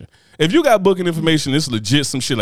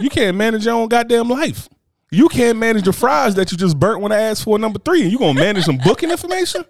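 mocking rant; 8 semitones higher than usual. Real, not acted. Roasting a fry cook.

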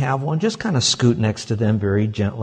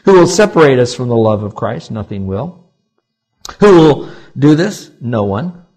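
loud preaching; 6 dB louder than usual. Acted. Call and answer.

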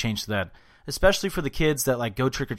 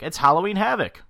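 higher, punched-up event-name call; mock announcer hype; 7 semitones higher, 4 dB louder than usual. Host mimicking the wrestling show's billing, mock hype. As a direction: say it like a hyped show title. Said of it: "they had to be like".

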